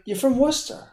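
high, incredulous mock of Worcester; scoffing you're from Worcester; mocking disbelief. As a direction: repeat it back, sneering at the claim. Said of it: "I'm like".

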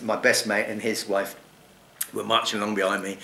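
usual speaking voice

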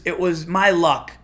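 ordinary delivery

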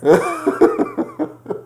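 high screaming laugh; hysterical laughing. Real, not acted. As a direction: laugh until it hurts.

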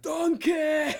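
loud, high Scottish ogre yell; hammed-up ogre shout; Shrek. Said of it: "Oh, there's Shrek".

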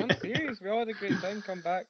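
high-pitched, amused insistence; laughing protest. Real, not acted. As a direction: protest your sincerity through laughter. Laughing protest after sarcasm remark.

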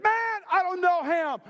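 high, loud, drawn-out mock protest; vehement denial. Peter disowns Jesus across the courtyard, loud acted vehemence. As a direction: cry it out as a hammed-up denial.

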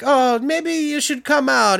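high, slow coaxing voice. Retold or imitated. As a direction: tempt him gently out. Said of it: "they're like".

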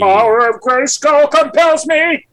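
high, slow hammy cartoon hero. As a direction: ham it up like a Saturday cartoon.

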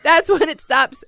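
slow, laughing, high. Real, not acted. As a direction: laugh the words out slowly.

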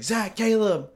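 imitated dad calling out, pitched high; high mock holler; retold yelling. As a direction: holler the names as if through the house. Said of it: "I hear him yelling".

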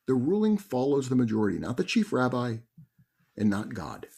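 ordinary talk, nothing marked

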